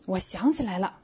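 normal talking voice

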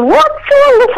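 shrill-free but very high mock yell; retold yell, very high. Repeating her yell at the husband, very high. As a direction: throw it out high as a startled yell. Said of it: "I yelled out".

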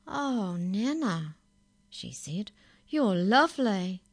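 put-on girl surprised by her lovely nana; amazed delight. A narrator's reading. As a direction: marvel at her.

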